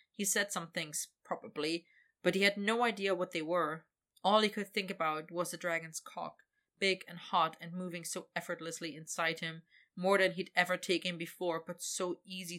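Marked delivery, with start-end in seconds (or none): none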